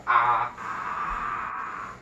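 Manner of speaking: long open-mouthed "aah"; held throat-check "ah". Shown as a demonstration. During a throat exam with mouth open, a held "ah". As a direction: say a sustained "ah" for the doctor.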